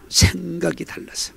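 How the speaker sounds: slow, soft whisper; whispered repeat. Shown as a demonstration. Preacher whispering the phrase for the congregation to repeat. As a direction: hush it for the congregation to echo. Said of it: "따라서 합시다".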